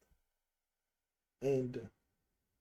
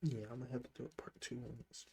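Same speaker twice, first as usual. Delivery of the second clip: fast whisper, much softer, no voice; 9 dB quieter, faster than usual. Real, not acted. To his viewers after crying, whispered. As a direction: say it under your breath, drained.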